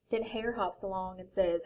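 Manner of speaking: lively picture book reading; reading to children. A narrator's reading. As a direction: read it like a bedtime story.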